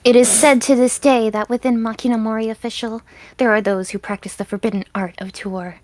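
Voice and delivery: hushed storyteller reading a legend; story narration. Acted. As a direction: read it like an old tale.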